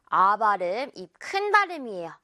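ordinary delivery

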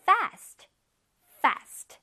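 "Fast" said singly with a clear F; teacher's careful "fast" with distinct F friction; careful F sound. Shown as a demonstration. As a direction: model "fast" twice, taking care over the opening F. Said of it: "F 발음 잘해줘야 돼".

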